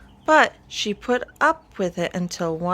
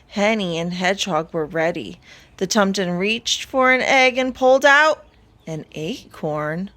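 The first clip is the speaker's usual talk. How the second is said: plain reading voice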